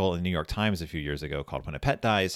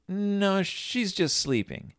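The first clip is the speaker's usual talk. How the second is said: high-pitched, drawn-out insistence; childlike denial; 12 semitones higher, much slower than usual. Retold or imitated. Friend denies his fish is dead.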